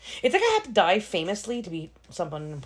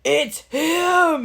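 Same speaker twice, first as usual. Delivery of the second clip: exasperated; 11 semitones higher, 8 dB louder than usual. Retold or imitated. Retells her dismay at the actor.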